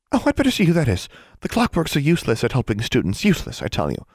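fussy grumble, starting high and falling; a hint of an exasperated librarian; lightly voiced fussy librarian. A narrator's reading.

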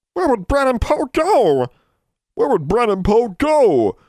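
very high, slow, loud elf wail. A narrator's reading. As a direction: wail it out in despair. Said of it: "they wailed".

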